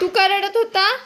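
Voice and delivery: put-on high, loud sing-song cooing to a baby; baby talk. Mother talking to her crying baby girl in high baby talk.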